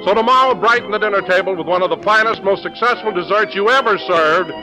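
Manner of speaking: bright announcer ad read; chipper 1950s ad. Acted. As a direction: sell the dessert with cheer. Jell-O commercial read.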